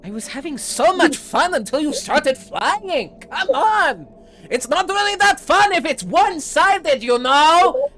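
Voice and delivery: high, loud exasperated protest; indignant complaint. Acted. Pirate complaining to a wizard who started flying, loudly indignant. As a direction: holler your grievance up at him.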